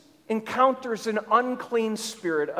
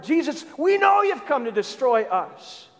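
raised, higher-pitched demonic challenge; calling him out; 5 semitones higher, 3 dB louder than usual. Acted. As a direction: confront him as the accusing spirits, voice up. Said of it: "they're calling him on the carpet".

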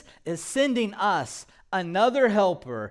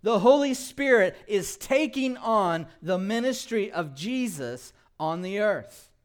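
ordinary talk, nothing marked

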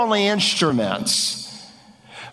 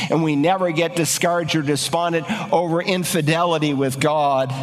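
normal talking voice

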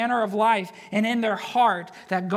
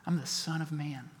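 quiet declaration; 5 semitones lower, 9 dB quieter than usual. Retold or imitated. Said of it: "You wanna know who I am?".

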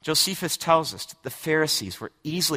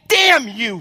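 1 forceful curse; 8 semitones higher, 7 dB louder than usual. Acted. Preacher voicing Jesus's curse.

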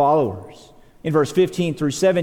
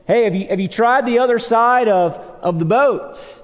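raised, louder call to fishermen; higher-pitched retold yell; mock yell; 8 semitones higher, 4 dB louder than usual. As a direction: call it out to people in a boat. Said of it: "He yells to them".

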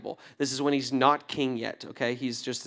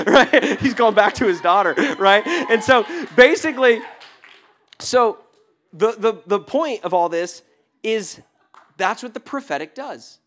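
usual speaking voice

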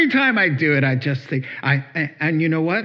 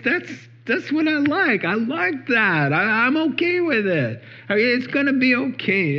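much higher, tender, eager comfort; lightly voiced Jesus, kind and encouraging; Jesus reassuring; 8 semitones higher than usual. Voicing Jesus reassuring the weary.

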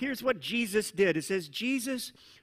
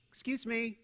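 mock polite request; 4 dB quieter than usual. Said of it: "That's not the way this worked".

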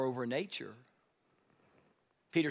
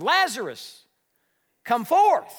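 loud, much higher ringing command; commanding call; 10 semitones higher, 12 dB louder, slower than usual. Acted. Jesus calls dead Lazarus out of the tomb, loud command. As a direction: call him out of the grave with full force.

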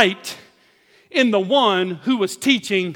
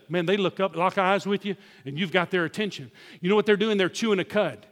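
ordinary delivery

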